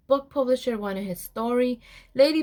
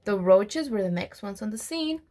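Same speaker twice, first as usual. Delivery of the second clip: usual speaking voice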